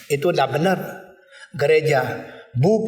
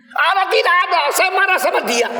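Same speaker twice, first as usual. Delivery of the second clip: much higher, loud mocking outburst; mock angry atheist; 13 semitones higher, 3 dB louder than usual. Preacher parodies atheist raging at absent God, high loud mockery.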